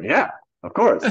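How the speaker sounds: high, loud, drawn-out bluffing yes; mock-emphatic going along with it; overdone agreement. Actor humouring the director about the audition, overemphatically.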